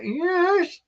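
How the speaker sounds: high forced chipper yes; fake cheerful yes. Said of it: "putting on this false smile".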